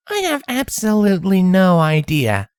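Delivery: dry, sarcastic filly voice; flat, mocking deadpan. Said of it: "Twilight deadpanned sarcastically".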